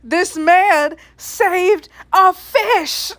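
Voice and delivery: high, very slow "This man saved a fish"; half-shouted, drawn-out "saved a fish"; exasperated emphasis. Real, not acted. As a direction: space out each word up to "fish".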